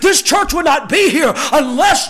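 preaching that rises to full force; impassioned pulpit build; building sermon. Real, not acted.

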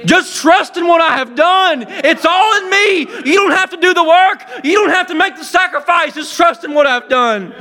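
full-force preaching with shouts. Acted. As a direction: preach it out to the congregation, building.

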